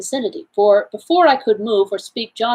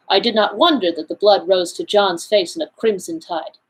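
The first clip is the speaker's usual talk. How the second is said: ordinary delivery, nothing marked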